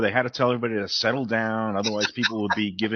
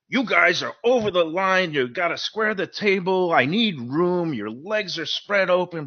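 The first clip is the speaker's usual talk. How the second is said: higher mock scold at the players; imitating her yelling; 7 semitones higher than usual. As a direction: act out a pushy woman scolding the table. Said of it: "she just starts yelling at us".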